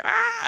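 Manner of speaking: high panicked "ah"; a sudden high yelp of panic. Retold or imitated. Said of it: "I'm, like, panicking".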